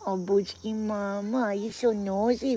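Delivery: slow, stretched-out cooing. Real, not acted. Baby talk to the dog.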